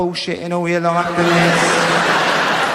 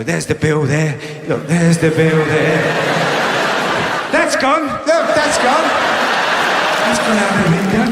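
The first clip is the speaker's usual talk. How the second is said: mock healer; 4 dB louder than usual. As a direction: ham up a fake healer dismissing the bill. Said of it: "I can go".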